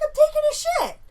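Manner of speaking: high, loud, put-on matter-of-fact voice; intruder's reply. Intruder tells the homeowner why he's there, high loud mock voice. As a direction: answer as if it's totally normal, pitched high and loud. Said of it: "He's like".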